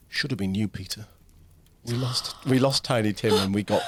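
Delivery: usual speaking voice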